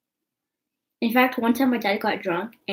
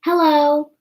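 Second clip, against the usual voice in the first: a higher, louder mock of his mom's cheery hello; 7 semitones higher, 10 dB louder than usual. Imitating his mom greeting her on the phone, higher and louder. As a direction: chirp it brightly like the surprised mom on the phone. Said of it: "and she just goes".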